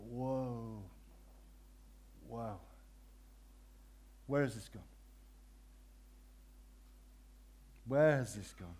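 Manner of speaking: soft, low dismayed thought; quietly stunned; shocked inner thought. Retold or imitated. Preacher recalling dismay at friend's swearing, soft and low. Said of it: "I thought".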